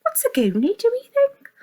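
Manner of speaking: usual speaking voice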